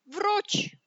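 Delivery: loud, high-pitched wolf roar; roared command. Acted. Wolf calls back the fleeing pig, loud roar. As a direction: roar it after someone escaping. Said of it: "ryknął wilk".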